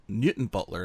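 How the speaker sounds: "Newton Butler" with the town reduced to a quick "tn"; local clipped pronunciation. Retold or imitated. Northern Irish speaker querying colleague, second syllable of Newton swallowed. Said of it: "you knock out words and you knock out letters".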